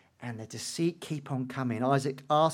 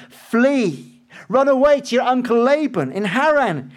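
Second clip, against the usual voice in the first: urgent; 9 semitones higher, 10 dB louder than usual. Acted. Rebekah urges Jacob to run.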